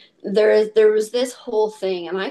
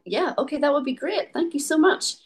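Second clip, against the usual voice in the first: grateful acceptance; 8 semitones higher than usual. Retold or imitated. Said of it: "I'm like".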